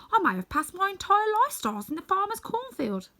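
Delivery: high, eager Scarecrow asking to be praised. Acted. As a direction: play the Scarecrow fishing for a compliment, pitched high.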